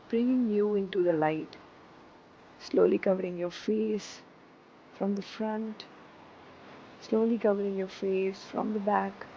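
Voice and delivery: calm, unhurried meditation guide; guided meditation. Acted. Teacher guiding students into seated meditation, calm. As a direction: settle them into stillness.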